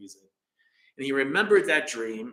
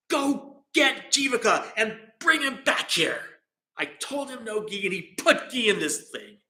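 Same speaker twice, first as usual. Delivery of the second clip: much higher, outraged royal order; angry king; 9 semitones higher than usual. Retold or imitated. King ordering his soldier, high and outraged. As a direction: bark the order like an indignant king.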